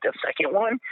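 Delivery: high, rising tone of disbelief; incredulous echo question. Retold or imitated. Airman answering dudes in the break room, incredulous echo. Echoes news of second plane.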